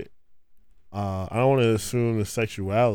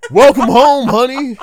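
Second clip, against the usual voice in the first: high, loud flirty greeting; welcoming someone home; 13 semitones higher, 15 dB louder than usual. Pretend. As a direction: coo it out to a spouse coming home. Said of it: "me naked in Tim's".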